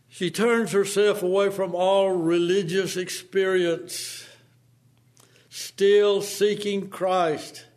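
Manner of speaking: fervent preaching rising. Acted. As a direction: build the sermon, pressing hard. Preacher rising to full force.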